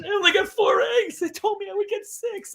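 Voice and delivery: hammed-up high sobbing complaint; imitating sobbing child. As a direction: wail it like a heartbroken little girl. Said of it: "beside herself and sobbed".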